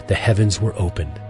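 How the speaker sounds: ordinary talk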